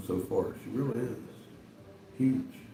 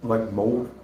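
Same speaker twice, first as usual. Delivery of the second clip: talking with food in mouth; mouth full. Real, not acted. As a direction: say it through a mouthful of sandwich. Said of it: "sandwich in my mouth".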